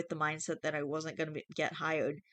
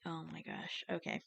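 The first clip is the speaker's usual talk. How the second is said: 1 hushed; 12 dB quieter than usual. Real, not acted. Reacting softly after reading.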